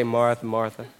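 low, soft, settling tone; gentle calming. Shown as a demonstration. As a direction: talk someone down with just their name. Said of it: "You just need to say".

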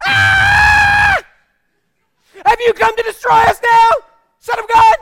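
very high, full-force acted scream; screamed outburst. Possessed man breaking into the middle of the sermon, screaming. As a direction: scream it out to startle the whole room. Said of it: "of speaking goes".

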